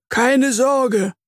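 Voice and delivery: high, assured promise; confident promise. Acted. Earthworm promises to protect his friends, assured. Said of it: "verspricht Herr Regenwurm".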